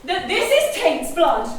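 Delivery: high, loud triumphant reveal with a laugh; spring the twist on them gleefully; loud, laughing big reveal. Acted.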